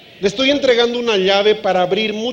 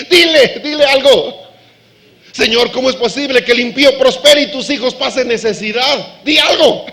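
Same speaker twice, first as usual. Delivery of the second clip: insistent plea; 5 semitones higher, 7 dB louder than usual. Acted. David demanding God speak.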